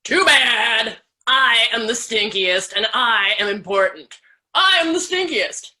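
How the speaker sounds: scary stink bug voice. Acted. Stink bug lecturing the bunnies, loud bossy scary voice. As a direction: lord it over them with a loud pompous bug voice. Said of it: "a scary voice".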